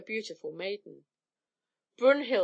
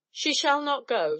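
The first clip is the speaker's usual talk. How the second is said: a higher, louder narrated shout; 6 semitones higher, 4 dB louder than usual. Acted. Frey shields his sister from the giant, shouting. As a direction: proclaim it to protect her. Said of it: "shouted Frey".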